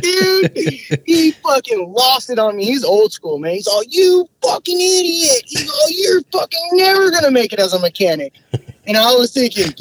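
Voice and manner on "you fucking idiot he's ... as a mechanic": high strained furious yell at the apprentice; furious boss yelling. Retold or imitated. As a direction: imitate the boss losing it completely.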